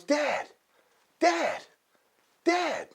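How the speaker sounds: put-on high, repeated child's call; cub calling Dad. Cub calling a father who won't listen, high pestering voice.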